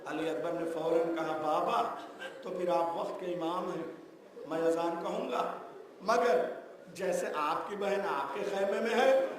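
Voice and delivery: high, loud impassioned shouting sermon; full-force preaching. Acted. Preacher cries out the Karbala azan scene to the mourners, high and loud. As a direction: cry out the climax to stir the mourners.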